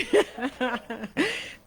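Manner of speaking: a bright laugh that climbs; rising laugh. Real, not acted. Grandmother laughing after her own comeback, rising laugh. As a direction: let the laugh run up.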